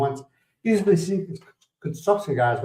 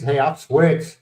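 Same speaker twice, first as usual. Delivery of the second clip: ordinary talk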